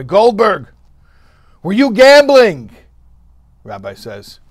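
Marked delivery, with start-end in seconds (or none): 0.0-2.7 loud, much higher shout of the chief; police chief shouting. Acted. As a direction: bark it out like a police chief busting a card game. Police chief confronts the rabbi.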